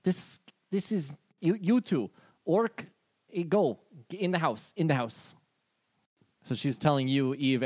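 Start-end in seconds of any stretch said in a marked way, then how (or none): 0.0-5.3 higher, odd shooing voice; Anna Meek ordering. Acted. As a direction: herd them into the house, pitched up. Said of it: "She looks at you, Benny, and she goes".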